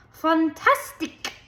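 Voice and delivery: high, slow, dramatic foreign Fantastic!; exclaimed in foreign accent. A narrator's reading. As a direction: gush it like a flamboyant dance teacher. Missus Walush exclaims.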